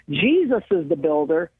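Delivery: normal talking voice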